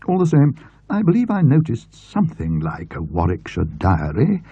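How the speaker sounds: a hint of a brisk, sociable bookish acquaintance; chatty friend. A narrator's reading. Talkative friend to Mister Denton at the book sale, lightly voiced. Friend recalls the diary's name.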